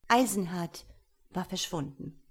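ordinary delivery, nothing marked

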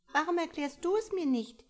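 put-on high, bright little-girl voice, curious. A narrator's reading. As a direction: ask like a small curious child, pitched high. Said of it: "Ein kleines Mädchen".

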